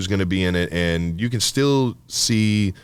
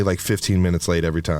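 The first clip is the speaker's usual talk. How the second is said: ordinary talk, nothing marked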